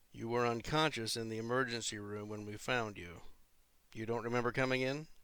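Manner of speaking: ordinary delivery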